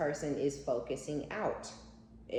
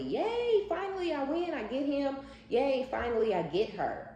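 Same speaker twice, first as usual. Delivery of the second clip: much higher, louder gloating squeal of victory; mock cheer; 11 semitones higher, 3 dB louder than usual. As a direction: squeal it like a giddy winner, mocking her. Mock cheer of the new partner.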